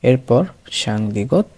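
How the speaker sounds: normal talking voice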